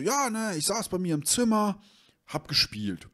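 high, sheepish voice of the buddy; nervous friend. Retold or imitated. Friend telling the owner about the broken game, high and flustered.

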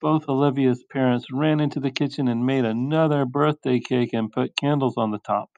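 usual speaking voice

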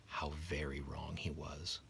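slow, hushed, foreboding narrator aside; ominous whisper. A narrator's reading. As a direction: whisper the warning to the listener, slowly.

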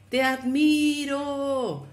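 the mountain echo answering the father; far-off echo. A narrator's reading. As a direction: let it float back from far away. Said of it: "La voz respondió".

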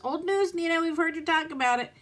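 a hint of bored viewers saying old news; mock viewers voice. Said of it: "okay, I know this is old news".

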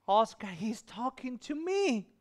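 slow, high-pitched wonder; acting out a wife's wonder; awed retold exclamation. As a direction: stretch it out in amazement, rising high. Repeats wife's awed answer.

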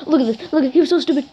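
high, excited gloating; freaking out. Real, not acted. Player mocking the loser after winning, excited. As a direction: crow over the beaten opponent. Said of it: "I'm really freaking out".

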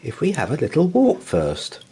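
high, quick motherly bear voice; a bright bustling mother bear. Acted.

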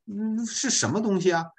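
high-pitched puzzled question; curious questioner. Acted. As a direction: ask as someone puzzled by the French mark. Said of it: "别人要问起你".

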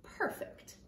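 very soft, whispered satisfaction; hushed bear. A narrator's reading. Bear approving his practice, hushed and satisfied. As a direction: whisper it, quietly pleased.

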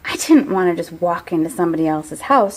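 usual speaking voice

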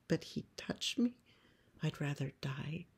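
soft, half-whispered objection; quiet, appalled refusal; hushed protest. Acted. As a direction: protest under the breath. Girl protests to the farm woman.

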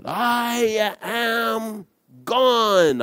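high, drawn-out call at no great volume; mock yell. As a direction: stretch each word high and slow. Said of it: "like, she's yelling".

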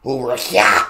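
mock German, hacking up a hairball; hairball in throat. Reactor mocking the German speaker, hairball-in-throat rasp. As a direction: rasp the word as if clearing the throat. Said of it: "like he got a hairball in his throat".

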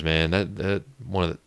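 ordinary talk, nothing marked

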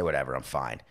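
imitating himself brushing off a friend; dismissive brush-off. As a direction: toss it away like it's nothing. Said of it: "I say".

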